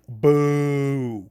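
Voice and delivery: drawn-out jeering boo; a long crowd boo; mock boo. Crowd jeering the heel, long boo.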